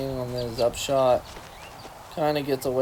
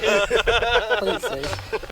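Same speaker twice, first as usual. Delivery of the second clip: laughing; 8 semitones higher, much slower than usual. Real, not acted. Joking about tapping the truck.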